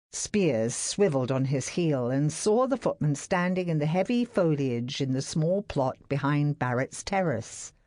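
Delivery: ordinary delivery, nothing marked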